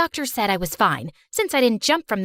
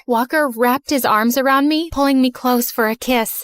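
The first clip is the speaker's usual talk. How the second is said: ordinary delivery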